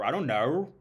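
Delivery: mock Australian accent. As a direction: mimic an Aussie twang. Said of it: "they put R's where R's don't go".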